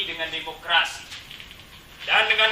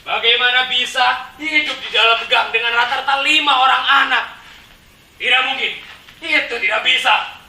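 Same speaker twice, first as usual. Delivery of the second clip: raised, louder voice of protest; flat-out refusal; 4 semitones higher, 5 dB louder than usual. Acted. Residents rejecting the plan for their crowded homes, forceful refusal. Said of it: "Kontan kami menolaknya".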